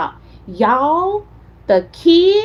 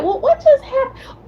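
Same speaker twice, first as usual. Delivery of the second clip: baffled; 13 semitones higher than usual. Retold or imitated. Baffled reaction to rude shoppers.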